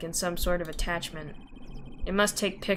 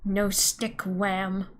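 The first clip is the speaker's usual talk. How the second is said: ordinary talk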